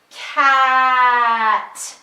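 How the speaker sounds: drawn-out sounds blended without a break; stretched slow blend. Shown as a demonstration. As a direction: stretch every sound but keep them joined. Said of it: "say the sounds together like this".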